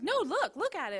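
lightly voiced boy demanding she look; persistent little boy. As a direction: push again like a kid who won't give up. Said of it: "so then he's persistent".

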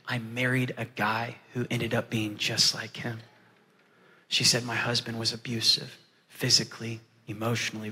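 low, hushed retelling of pain; subdued testimony. Retold or imitated. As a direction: recount her hurt softly and soberly. Retells a woman's painful testimony.

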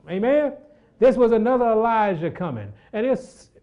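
high, loud preaching, settling down; fired-up preacher voice; preaching at full force. Acted. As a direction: lift the congregation, then ease off.

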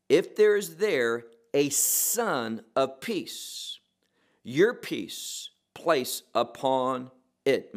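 slow, weighty reading, "there" pressed; stress on there. Shown as a demonstration. Preacher rereading verse six, pressing "there" slowly. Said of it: "there's an emphasis on the word there".

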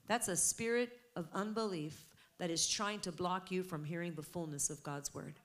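ordinary delivery

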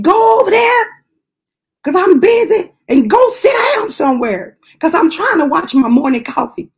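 high, mock-shouted dismissal; exasperated scolding. Worker sends away patient interrupting Morning Coffee, exasperated scolding.

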